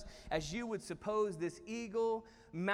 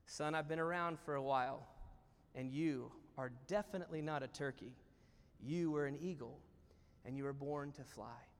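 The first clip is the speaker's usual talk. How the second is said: put-on low, soft, measured old owl; wise reassurance; 6 semitones lower, 6 dB quieter than usual. Wise owl tells a young eagle his true nature, gently sure. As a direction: lean in with quiet certainty. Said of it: "leans in with wisdom and he says".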